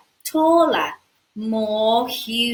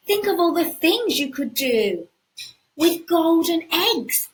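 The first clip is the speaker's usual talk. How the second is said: put-on boyish voice; 9 semitones higher, 4 dB louder than usual. Jack thinks.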